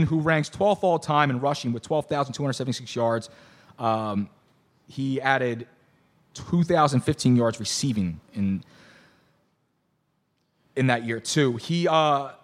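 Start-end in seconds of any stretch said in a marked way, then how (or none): none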